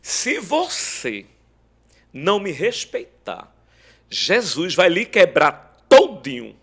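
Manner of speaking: high, loud and slow; stern warning. Retold or imitated. Wife holding a knife warns her husband, stern loud warning. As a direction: drive the warning home, rising to a loud finish. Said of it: "foi a forma dela falar".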